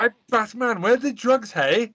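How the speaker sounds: put-on high, sing-song Welsh accent; Welsh accent Batman. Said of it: "what accent is that?".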